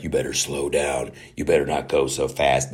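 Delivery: low, soft warning. Retold or imitated. Grand Inquisitor warning her, low soft caution. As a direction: murmur a warning. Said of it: "Grand Inquisitor is like".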